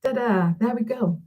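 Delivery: normal talking voice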